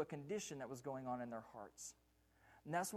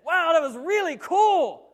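mock amazement; 9 semitones higher, 17 dB louder than usual. Disciples gushing about the miracle, hammed-up amazement. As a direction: gush like a thrilled fan. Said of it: "Great amazement".

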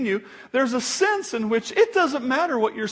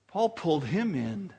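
ordinary delivery

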